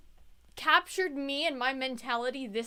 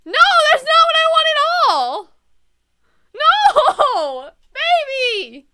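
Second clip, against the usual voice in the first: high, loud wail of protest; hammed-up despair; 11 semitones higher, 16 dB louder, much slower than usual. Player protesting Neil's words, hammed-up heartbroken wail. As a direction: wail it at full volume.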